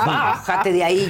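loud, drawn-out heckle; retold shouting. Pita orders the actress off stage, shouting. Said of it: "me empezó a gritar".

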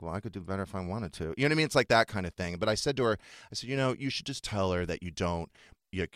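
ordinary delivery

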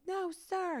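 high, tearful mock wail; imitating crying child. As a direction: answer like a defiant kid in tears. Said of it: "she's crying".

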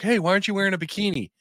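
high, fast, hammed-up commenter voice; mimicking fans who demand a bikini, high and fast; mocking viewers. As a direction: imitate the pushy fans. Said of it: "And people are like".

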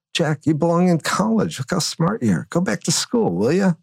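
lightly voiced mentor nudging him back to college; kindly boss. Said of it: "he looks at me and goes".

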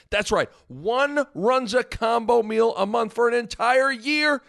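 excited. Acted. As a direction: pitch climbing high on year. Prize repeated, hyped up.

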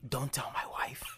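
imitated whispered plea; secretive whisper. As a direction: whisper it like a husband hiding a secret. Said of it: "they don't wanna be heard".

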